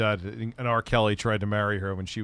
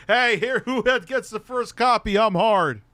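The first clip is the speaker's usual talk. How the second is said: high, loud mock announcement; imagined eager clerk; 12 semitones higher, 4 dB louder than usual. Clerk crudely hailing customers about the first copy, mock announcement. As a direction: call it out high and excited. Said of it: "Imagine, like, that's like".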